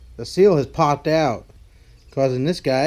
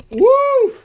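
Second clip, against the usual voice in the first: high, loud whoop; excited whoop; 9 dB louder than usual. Real, not acted. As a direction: let out a wild cheer. Cheer after cold drink.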